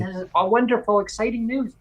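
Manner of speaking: high-pitched, gushing retold delight; mock excitement. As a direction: act out being thrilled by news. Acts out delight at the news.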